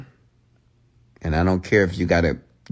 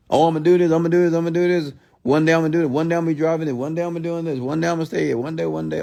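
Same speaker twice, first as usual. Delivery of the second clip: higher-pitched, repetitive mock promises; mocking imitation; 7 semitones higher, faster than usual. Streamer mimicking people who only talk about plans, mocking. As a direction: rattle off empty promises in a mocking run.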